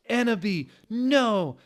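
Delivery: high, loud panicked cry; desperate high scream. Acted. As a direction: yell to stop her. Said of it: "screamed Draco".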